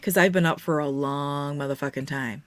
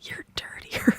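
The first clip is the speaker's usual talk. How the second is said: teasing whisper; slower than usual. Pretend. Whispered tease back.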